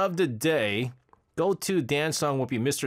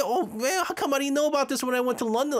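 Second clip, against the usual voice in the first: amazed; 12 semitones higher, faster than usual. Real, not acted. Amazed he missed the tour.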